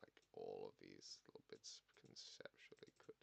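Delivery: slow, very soft whisper; whispered aside. Real, not acted. Sudden hushed aside in the scene.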